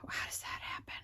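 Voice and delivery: very soft whisper, puzzled; whispered muttering to oneself; whispering to herself. Real, not acted. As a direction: whisper it under your breath. Murmuring to herself at work.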